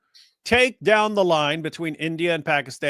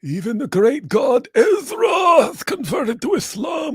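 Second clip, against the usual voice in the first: mock proclamation; 10 semitones higher than usual. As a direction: proclaim it like a pompous prophet. Mock proclamation of converted god.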